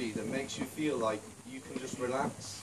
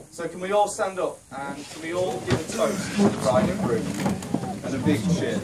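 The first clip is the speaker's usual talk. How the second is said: wedding toast speech; 5 dB louder than usual. Real, not acted. Calls the room to toast.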